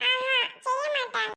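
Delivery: put-on high-pitched little child voice; small child. Child telling uncle what mother won't cook, small child voice. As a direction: answer as a tiny child.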